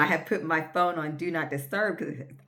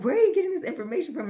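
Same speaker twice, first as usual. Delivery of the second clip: incredulous; 12 semitones higher, 5 dB louder, much faster than usual. Retold or imitated. Miss Debbie challenging her.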